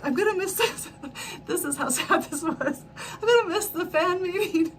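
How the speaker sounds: high, laughing, half crying; laughing lament. Real, not acted. Moaning to viewers about missing the fan meeting, laughing. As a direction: wail it through laughter.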